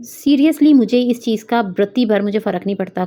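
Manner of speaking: usual speaking voice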